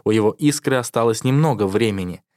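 ordinary delivery, nothing marked

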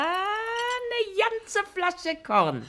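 high, slow, delighted showing off the bottle; triumphantly presenting the schnapps; proud reveal. Acted. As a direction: draw it out with glee, high.

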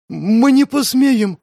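high, slow scared cry; terrified cultist begging; frightened plea. Acted. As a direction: cower before the master. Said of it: "пугливо опустил он голову".

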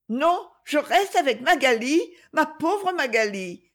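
put-on high-pitched, insistent child; small boy's fond protest; loyal little boy. A narrator's reading. Small brother tenderly refuses to leave.

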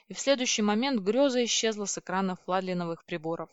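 usual speaking voice

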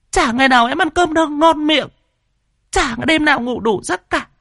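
high mock lament; wailing widow. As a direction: wail it like a grieving wife. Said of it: "con mụ Liên mới gào khóc".

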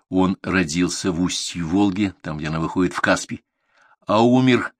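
usual speaking voice

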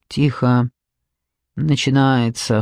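low, slow, sullen order; understated gruff hushing; gloomy cut-off. A narrator's reading. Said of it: "хмуро оборвал их Луп".

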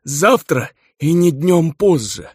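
high, slow stubborn demand; stubborn dwarf patriarch. Acted. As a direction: insist like a stubborn old dwarf.

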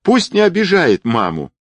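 put-on high-pitched excited boy; high, indignant child's cheer; joyful shout. A narrator's reading. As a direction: cry out eagerly like a little boy. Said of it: "радостно закричал мальчик".

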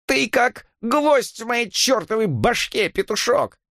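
very high, drawn-out scolding; shrill exasperation. A narrator's reading. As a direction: scold him in a screechy voice. Exasperated landlady snaps back at him. (визгливо, с раздражением)

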